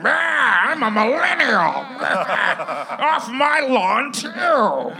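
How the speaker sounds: put-on high, loud, slow cranky old man; curmudgeon-y old man. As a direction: shout like an old man yelling at kids. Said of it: "your impression of millennials as curmudgeon-y old men".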